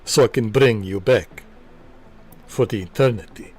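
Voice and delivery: a hint of a brooding vampire count; lightly voiced Dracula, proud and devoted. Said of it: "I am Dracula".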